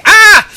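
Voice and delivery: very high, loud fan scream; short shriek of excitement; excited squeal. Real, not acted. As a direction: squeal with overflowing delight. Overcome by love of the game.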